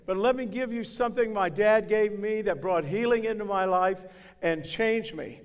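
normal talking voice

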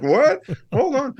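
hammed-up surprise at the baby; mock shock. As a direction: jolt up in fake alarm. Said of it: "It's like".

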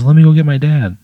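put-on high, loud bright greeting of a young girl; little neighbour girl greeting Pat, high and loud; excited little girl. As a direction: chirp it like a happy kid at the door. GM voices excited little girl Moira.

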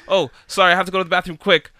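loud, fast mock girl voice rushing out an excuse; imitating her excuse. Mocking the girl rushing to the bathroom, loud and fast. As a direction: blurt it fast like a hurried excuse.

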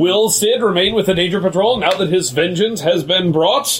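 cliffhanger hushed stop. Pretend. Cliffhanger teaser questions.

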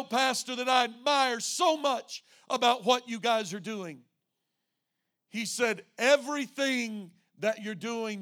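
loud, high, driving sermon delivery; full-force preaching. Real, not acted. Pastor telling the church of a visitor's praise, loud preaching.

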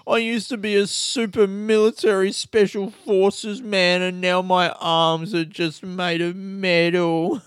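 slow, whiny mock lament of a hero; sulky mock hero lament; mock whiny soldier. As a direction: whine it like a self-pitying action hero. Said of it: "And he's like".

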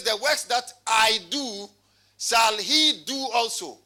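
"I" and "he" punched loud and slow; emphatic slow reading leaning on "I" and "he"; stress on I and he. Shown as a demonstration. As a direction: stretch "I do", then hit "he". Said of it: "I'm emphasizing on who".

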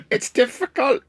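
laughing, high strained North Welsh accent; North Welsh attempt. Shown as a demonstration. Struggling to demo the North Welsh accent, laughing. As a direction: try a North Welsh lilt and fail, laughing. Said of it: "It's difficult for me to do".

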